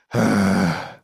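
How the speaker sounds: low rumbling sigh from the throat; deep heavy sigh. Shown as a demonstration. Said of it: "So when we sigh".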